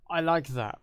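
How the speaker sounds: a bright, satisfied verdict on your work; approving your own work. Retold or imitated. Judging your own conlang work, pleased and approving. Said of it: "look at it and say".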